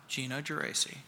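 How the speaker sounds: low, very quiet name drop; understated punchline, low and soft; hushed deadpan reveal. Acted. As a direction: drop the name softly as the verdict. Announcing himself as the vote winner.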